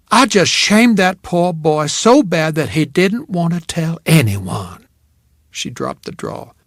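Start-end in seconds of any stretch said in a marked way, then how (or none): 0.1-4.9 put-on deepened Southern accent. A narrator's reading. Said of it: "Southern accent deepened".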